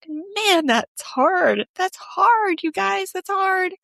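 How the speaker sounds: high, tearful voice cracking on the words; choking up for real while talking about the goodbye hug; real tears welling, pitch jumping high; voice breaking. Real, not acted. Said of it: "yeah, I was emotional".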